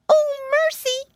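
very high, loud, drawn-out wail; piglet's frightened cry. A narrator's reading. Pinky in the wolf's paws, crying out. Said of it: "cried Pinky".

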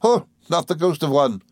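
high, quick, chattering little woman; Miss Mowcher voice. Acted. Mowcher chattering to the boys, high and merry. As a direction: rattle on like a gossiping dwarf.

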